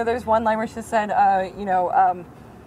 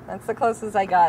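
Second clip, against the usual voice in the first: ordinary talk, nothing marked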